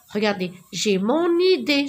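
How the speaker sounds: "mon idée" with the n linked on, said mɔn ide; liaison in mon idée. Shown as a demonstration. Teacher to pupils, showing the liaison in "mon idée". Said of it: "Je fais la liaison".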